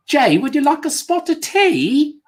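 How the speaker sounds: high, hammed-up British accent; mock posh English lady offering tea; horrible British accent. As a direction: put on a terrible British accent for Jay. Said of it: "do a horrible British accent".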